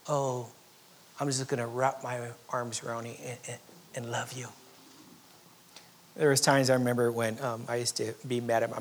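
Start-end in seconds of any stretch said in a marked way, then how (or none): 0.1-4.5 softer, lower and fading into tenderness; tender God. Pretend. God answering angry people, soft and tender. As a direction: hold them close with your voice.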